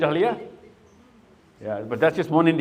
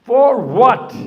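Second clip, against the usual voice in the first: "For what?" higher and much louder; dramatic question; 9 semitones higher, 9 dB louder than usual. Acted. Teacher challenging students about the war, loud and high. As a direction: hurl the question at the class.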